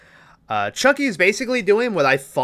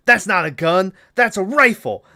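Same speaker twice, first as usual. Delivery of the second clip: louder and higher, barking correction; mock drill sergeant; 7 semitones higher, 7 dB louder than usual. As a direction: snap at the recruit.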